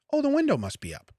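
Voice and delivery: ordinary talk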